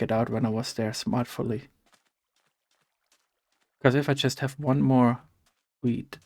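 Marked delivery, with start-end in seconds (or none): 5.8-6.2 "wheat" voiced at the end, almost "weed"; wheat sounding like weed. Real, not acted. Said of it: "It sounds like I say weed every time".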